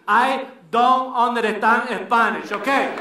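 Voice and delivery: put-on slow accented indignant denial; Dominican woman denying. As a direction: deny it in a thick accent, slowly. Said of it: "she cleverly disguised it by saying to me".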